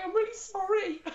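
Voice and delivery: high, laughing take on the apology; imitating her friend. Retelling her friend's apology, high laughing imitation. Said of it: "She was, like, going".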